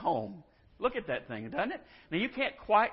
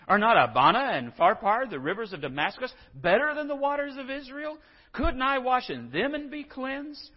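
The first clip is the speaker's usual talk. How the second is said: raised, higher, louder voice of an offended general; Naaman's indignant complaint; 7 semitones higher, 6 dB louder than usual. Retold or imitated. Preacher voicing Naaman's angry complaint, raised and indignant. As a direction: let the grievance swell as he lists his demands. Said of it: "Naaman went away angry and said".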